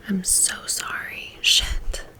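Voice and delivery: usual speaking voice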